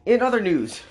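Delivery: loud announcer opener; news anchor voice. Acted. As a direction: boom it like a newscaster.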